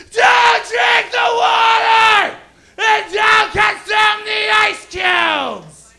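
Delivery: high, slow, drawn-out mock holler; frantic family shouting. Family running after the plane on the tarmac, hammed-up frantic shout. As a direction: scream it as if chasing a plane. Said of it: "my family's running down the tarmac".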